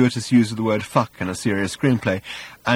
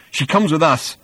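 higher, firm counter-claim; insist, voice pushed up; insistent; 6 semitones higher, 4 dB louder than usual. A narrator's reading. Arthur disputing Thor over the girl, insistent.

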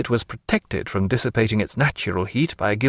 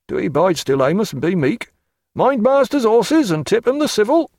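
high, loud country postboy; rustic postboy calling; 9 semitones higher, 3 dB louder than usual. Acted. Postboy calls back to chaise behind, high rustic. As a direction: call back to the other coach, higher. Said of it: "called back to the chaise behind".

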